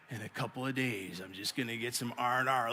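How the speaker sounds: low, very soft aside to himself; hushed longing. Acted. Weary pastor confiding at the fifth service, hushed longing. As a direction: murmur it low, almost under the breath.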